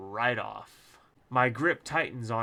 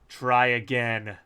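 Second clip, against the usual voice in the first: slow, louder, deadpan demand; flat voice; 8 dB louder, slower than usual. Acted. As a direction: say it with a blank stare. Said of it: "I say with a flat voice and a blank stare".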